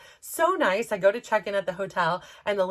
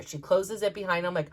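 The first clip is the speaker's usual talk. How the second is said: ordinary talk, nothing marked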